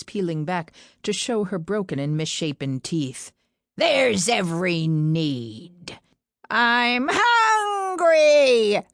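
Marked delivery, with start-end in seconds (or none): 3.8-6.0 put-on slow, sinister old witch; menacing crone voice. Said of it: "almost as a screech". 6.5-8.8 high, loud, slow screech; crone's screech. Acted. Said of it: "The last word came out almost as a screech".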